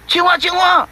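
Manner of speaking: high, loud call of the frog's name. Acted. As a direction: call and wait for an answer. Calling the dead frog.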